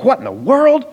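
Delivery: high squeaky bird voice, thrown off; a little bird yelps as its tree shakes; startled bird. Acted. Lumberjack hits the bird's tree, the bird squeaks in high alarm. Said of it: "And the bird's like".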